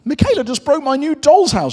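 pitched right up, protesting; upset little girl. Acted. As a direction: play an aggrieved storybook girl. Upset girl complains in story.

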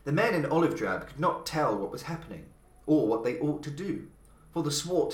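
ordinary delivery

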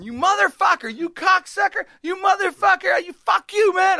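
high, loud ranting yell; screaming neighbor. Retold or imitated. Crazy neighbor swearing out his window at nobody, screaming. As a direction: scream curses like a crazed man. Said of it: "This guy's just screaming at his window".

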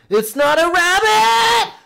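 high, drawn-out full-force shriek; hammed-up horror-movie scream; terrified scream. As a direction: scream it slowly, stretching the last word. Acted scream of the startled son.

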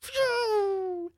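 high, drawn-out vocal whoosh; falling whoosh sound effect. Shown as a demonstration. Gamer jumping out of helicopter, imitated high whoosh. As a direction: mimic someone plummeting through the air. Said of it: "That's my sound effect for jumping out the helicopter".

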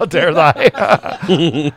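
very slow, laughing, far-off "How dare I?"; slow laughing mock outrage; mock old lady. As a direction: stretch it out, shocked and laughing.

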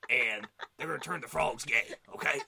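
loud, rough ranting broadcaster voice; Alex Jones impression. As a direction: rant like a conspiracy radio host. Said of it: "that's how he sounds".

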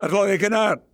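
high, run-together Yorkshire dialect call. Shown as a demonstration. Boy at a friend's door asking him out, Yorkshire dialect. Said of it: "I spoke dialect".

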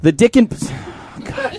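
ordinary talk, nothing marked

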